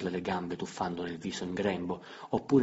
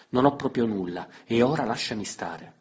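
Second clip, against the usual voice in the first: louder and higher, brushing him off; curt annoyed refusal; 4 semitones higher, 8 dB louder than usual. Acted. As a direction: push him away with irritation. Emilia lo respinge seccata. (rifiuto seccato, più forte)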